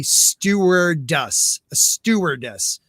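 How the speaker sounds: "stewardess" drawn out slowly, syllable by syllable; said really slow. Shown as a demonstration. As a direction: take "stewardess" slowly and carefully. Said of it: "I have to say it really slow".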